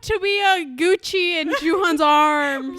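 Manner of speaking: loud, high, drawn-out swoon; over-the-top fangirl yearning. Real, not acted. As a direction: wail it longingly, slow and big.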